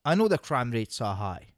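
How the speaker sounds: ordinary talk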